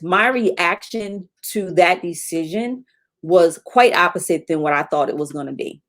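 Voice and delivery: ordinary talk, nothing marked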